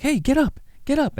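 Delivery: high, insistent call; different voice. Acted. Will Forte rousing Darwin after the crash, high voice. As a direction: call him awake in a high voice. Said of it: "it's the different voice".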